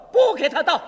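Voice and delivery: brother's loud shouted refusal; loud cry. Acted. Brother overhears the call for water, loud cry. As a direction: call it out sharply from another room. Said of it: "一个声音高叫道".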